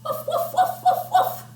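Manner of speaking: quick high-pitched woofs; dog bark. Pretend. Reader barking like a dog for children, high woofing. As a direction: bark like an excited little dog. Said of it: "so we'll bark".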